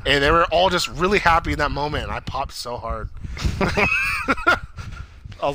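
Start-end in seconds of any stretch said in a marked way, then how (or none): 3.1-5.4 high, breathy burst of laughter; real laugh. Real, not acted. Fan reliving a scene he loved, real delighted laughter. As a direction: laugh hard, high and airy. Laughing after popping so hard.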